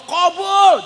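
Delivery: high loud call; comic loud answer; punchline call. Real, not acted. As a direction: bang out the answer.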